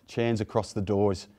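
ordinary talk

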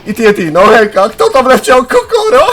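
loud, rising into high squealing laughter; gleeful, laughing high-pitched outburst; amused shout. Real, not acted. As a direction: shout it out as you crack up. Someone flung by TNT, amused.

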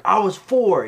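put-on high, loud kid-like protest; indignant retort. Brandon Lee retorting to Dolph, loud and high. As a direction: snap back defensively. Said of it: "He's like".

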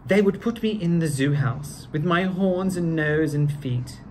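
ordinary delivery, nothing marked

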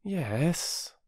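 high, reluctant yes; doubtful. Acted. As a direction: concede it with misgiving. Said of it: "said Bobby doubtfully".

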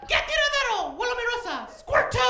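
put-on very high, loud old granny voice in Spanish; grandmother's voice in Spanish. Grandson repeats grandmother's words in Spanish, very high and loud. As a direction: squeal like a tiny abuela pushing frijoles. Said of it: "puedo oír su voz diciendo".

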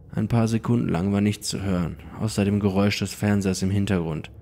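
ordinary delivery

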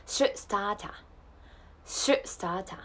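"start a" as "stah-ta", clear T; slow model of "start a" without R; Australian pronunciation. Shown as a demonstration. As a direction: stop the T in "start a", open final "ah". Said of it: "あーで大丈夫です。".

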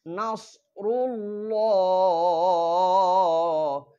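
slow recited নাসরুল্লাহ; tajweed recitation. Shown as a demonstration. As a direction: chant নাসরুল্লাহ slowly with a clear সোয়াদ. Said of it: "নাসরুল্লাহি যেন না হয়".

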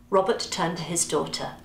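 usual speaking voice

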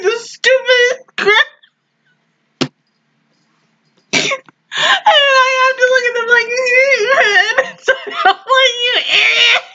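very high voice breaking into laughs; helpless laughter. Real, not acted. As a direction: squeeze the words out between real giggles. Cracking up over her own story.

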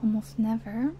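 ordinary talk, nothing marked

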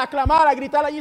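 ordinary talk

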